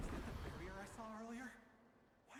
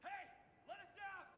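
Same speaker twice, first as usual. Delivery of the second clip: trapped shout; 7 semitones higher than usual. Acted. Trapped hero shouts for someone to let them out. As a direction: yell through the wall to be let out.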